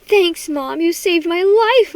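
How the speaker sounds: loud, high outburst of thanks; building to a high, drawn-out exclamation; relieved cry. A narrator's reading. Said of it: "I cried".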